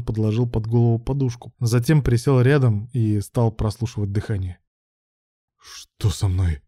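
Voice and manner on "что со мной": low, slow rasp; wheezing. A narrator's reading. Ruslan waking on the couch, weak wheeze. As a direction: croak it out weakly. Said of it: "прохрипел Руслан".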